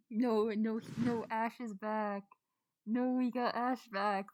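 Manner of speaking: loud, drawn-out wail of no; dismayed protest. Real, not acted. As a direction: protest loudly, dragging the words out. Dismayed that Ash has returned.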